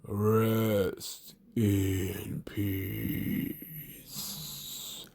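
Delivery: very slow, deep, drawn-out intonation; slow, low impression of a wrestler's catchphrase; Undertaker. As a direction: stretch each word out low and slow. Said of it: "Undertaker used to speak a very slow".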